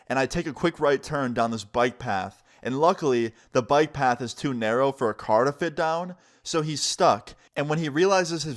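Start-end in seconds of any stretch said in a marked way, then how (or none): none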